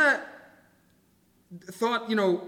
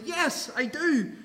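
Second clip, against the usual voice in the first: higher, slow, insistent yes; emphatic Jonah; 7 semitones higher, slower than usual. Acted. Preacher voicing Jonah's stubborn reply, emphatic and slow. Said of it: "Jonah responds emphatically".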